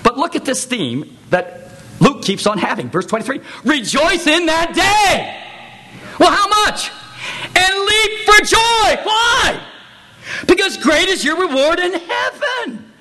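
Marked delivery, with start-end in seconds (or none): 3.6-12.9 high, loud exultant proclamation; rousing scripture cry, far higher; preaching at full force. Real, not acted. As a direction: shout it to the congregation.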